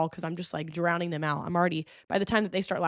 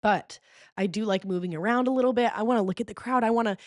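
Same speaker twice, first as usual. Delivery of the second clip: normal talking voice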